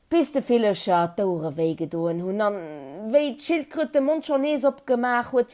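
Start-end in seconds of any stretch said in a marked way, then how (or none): none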